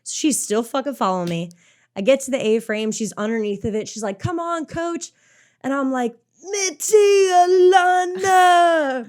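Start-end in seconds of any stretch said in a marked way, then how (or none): 4.2-5.1 high, light cheer without volume; higher-pitched mock encouragement; imitated cheering friend. Retold friend cheering from below. 6.4-9.0 very high, drawn-out loud wail; imitated straining shout for attention; desperate calling out. Retold desperate calling to friend.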